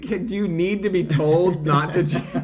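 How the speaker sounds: high, loud, laughing disbelief. Real, not acted. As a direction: laugh through the question. Incredulous laughing remark.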